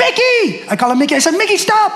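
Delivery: put-on high-pitched cry to stop a child; urgent call. Dad to daughter about to jump in pool, urgent call. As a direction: call out high and sharp to halt her.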